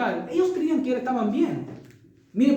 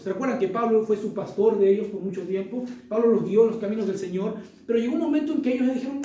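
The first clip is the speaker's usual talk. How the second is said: usual speaking voice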